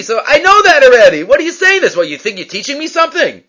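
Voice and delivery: indignant Rav Huna. Retold or imitated. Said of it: "That's why he got upset".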